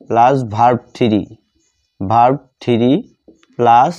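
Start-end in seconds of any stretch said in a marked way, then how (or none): none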